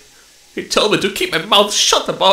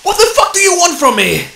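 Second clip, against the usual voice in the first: angry outburst; 7 semitones higher than usual. Acted. Cornered officer snapping at his interrogator, angry. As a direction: lash out at him, rattled. Cornered lieutenant confronts stranger.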